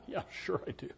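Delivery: put-on whispered sarcastic kid reply under the breath; sarcastic aside. Child grumbling back at mom about medicine, whispered sarcasm.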